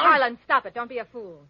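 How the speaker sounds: loud shout to break up a fight; commanding shout. Acted. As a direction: yell to stop him now. Stopping a fight.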